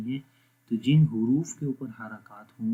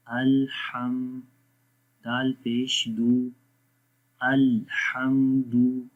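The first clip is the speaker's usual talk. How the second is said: slow, measured recitation on a level pitch; drawn-out, evenly intoned syllables; Quranic spelling drill; much slower than usual. Shown as a demonstration. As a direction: chant each letter, vowel and syllable.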